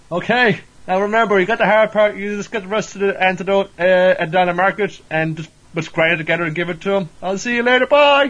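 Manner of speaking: high-pitched acted parting instructions; hurried send-off. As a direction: call out a goodbye, voice climbing to the end. Acted hasty instructions and escape.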